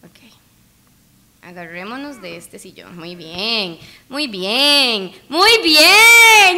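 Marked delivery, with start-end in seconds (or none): 3.0-6.6 building encouragement. Real, not acted. Player cheering Ricky's climb, building excitement.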